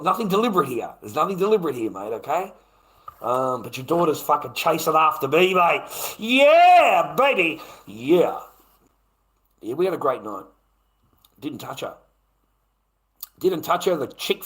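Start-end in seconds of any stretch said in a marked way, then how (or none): 6.2-8.4 exulting. Real, not acted. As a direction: crow it like a winner.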